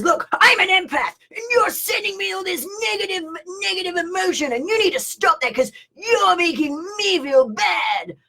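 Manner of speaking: high, mock-wounded shrill protest; indignant complaint. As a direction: mimic an offended empath scolding. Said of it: "suddenly she says".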